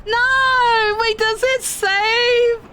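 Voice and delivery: high, loud panic; panicked. Real, not acted. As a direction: panic over the frozen game. Game froze mid-climb.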